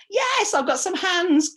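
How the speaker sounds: delighted someone answered; pleased surprise. Real, not acted.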